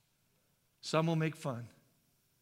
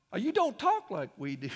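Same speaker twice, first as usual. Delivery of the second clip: imitated puzzled onlooker; 3 semitones higher, 8 dB louder than usual. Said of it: "lost your heavenly accent".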